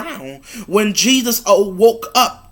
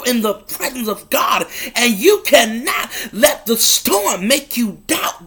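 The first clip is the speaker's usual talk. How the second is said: repeated lines, each one pushed harder, voice starting to crack; building preaching; 2 semitones higher than usual. Acted. Preacher pressing the congregation not to doubt, building with emotion. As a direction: preach it home, line after line.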